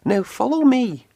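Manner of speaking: high, friendly lead-the-way engine voice; Thomas the engine. A narrator's reading. Thomas telling Percy to follow, high engine voice. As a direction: call him along, high and bright. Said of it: "puffed Thomas".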